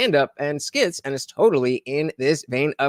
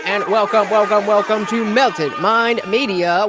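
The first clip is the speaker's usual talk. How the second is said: laughing welcome; 4 semitones higher, 4 dB louder than usual. Real, not acted. Streamer welcoming a supporter.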